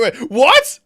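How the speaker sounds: loud, high startled outburst; surprised. Real, not acted. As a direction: blurt it in shock. Sudden warnings appear.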